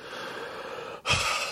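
long, breathy anxious sigh; real weary exhale; heavy sigh. Real, not acted. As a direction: let out a long nervous breath. Anxious before dental appointment.

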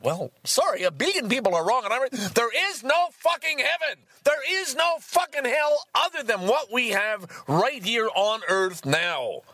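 defiant rant. Real, not acted. Rejecting the book's claims, defiant high rant. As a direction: plant your flag and refuse to budge. Rant against heaven and hell.

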